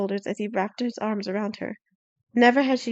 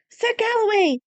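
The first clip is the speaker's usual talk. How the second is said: calling out; 12 semitones higher than usual. A narrator's reading. Desmond calls to the knight riding past, shouting. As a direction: shout his name to catch him. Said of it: "Desmond shouted".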